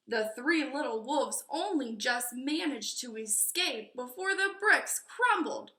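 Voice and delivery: ordinary delivery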